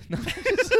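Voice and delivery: high laugh breaking through words; laughing at his own joke. Real, not acted. Laughing at his own salad line to friends.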